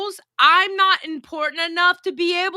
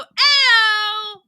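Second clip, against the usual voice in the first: imitating Tina's greeting; 7 semitones higher, 5 dB louder than usual. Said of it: "she would go".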